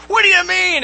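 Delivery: high, loud mock outrage; hammed-up indignant yell; yelling back. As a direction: shriek it back in disbelief. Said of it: "And he starts yelling back".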